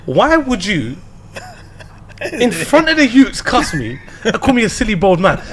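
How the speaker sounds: high, loud indignant complaint, near laughter; hammed-up outrage at being insulted; mock arguing. Husband objecting to being cussed before the youths, mock indignant. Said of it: "I probably had an argument".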